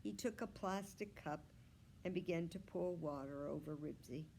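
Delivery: plain reading voice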